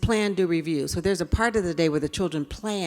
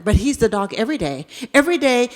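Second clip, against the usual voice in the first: ordinary talk, nothing marked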